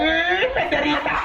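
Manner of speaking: "eh, Derita" yelped high and sudden; startled blurt. Retold or imitated. Mother in labour blurting the baby's name, sudden high cry. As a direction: cry it out like it slipped during labour.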